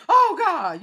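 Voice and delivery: high loud cry with a laugh; mock sex cry laughing. Imitating a woman crying to God in bed, loud and laughing. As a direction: squeal it out, half laughing. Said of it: "Don't act like y'all ain't".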